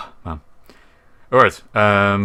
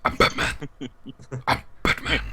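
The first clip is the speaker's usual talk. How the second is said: Batman voice; 4 semitones higher, 4 dB quieter than usual. Retold or imitated. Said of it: "you gotta go Batman".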